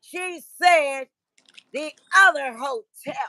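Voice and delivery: high, loud, slow indignant lecture; mock his angry scolding; imitating an angry man.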